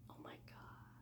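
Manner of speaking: breathy whispered oh my god to herself; a sudden embarrassed whisper, no voice at all; whispered dismay. Real, not acted. Blanking on a character's name, whispering in dismay.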